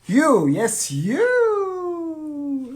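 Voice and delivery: loud, high emphatic you; emphatic call-out. Real, not acted. Calling straight out to Lou, loud and high. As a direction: jab the word you at Lou.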